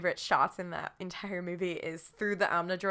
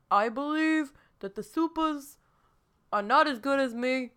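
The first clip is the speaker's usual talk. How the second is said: botched Trump impression; 5 semitones higher than usual. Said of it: "That sounds like Bernie Sanders".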